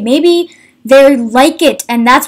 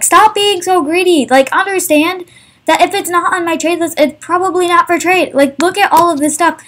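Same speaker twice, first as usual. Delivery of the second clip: frustrated rant; 5 semitones higher than usual. Real, not acted. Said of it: "how much this upsets me".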